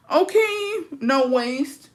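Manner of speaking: squeaky high mock greeting; teasing high squeal. Mocking a skinny chatter.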